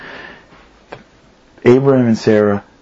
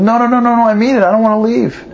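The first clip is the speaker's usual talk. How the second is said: put-on high, insistent child's voice begging to stay; a frightened son insisting he means it; high child pleading not to be sent away; pleading little boy; 9 semitones higher, faster than usual. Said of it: "starts to cry and says".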